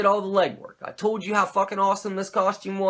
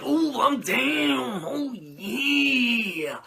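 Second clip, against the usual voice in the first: Macho Man impression; 6 semitones higher, much slower than usual. Macho asking Sting about the Dungeon of Doom, in a growling Macho Man voice. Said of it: "Macho Man's like".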